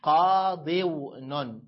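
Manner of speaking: قاضون stretched out very slowly; قاضون said slowly, each sound held; very slow demo. Shown as a demonstration. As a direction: draw out قاضون for the class to hear. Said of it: "فلو أبدلت الواو في قاضون".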